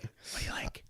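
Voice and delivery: hushed, whispered repeat of a question. Real, not acted. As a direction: whisper it, barely any voice.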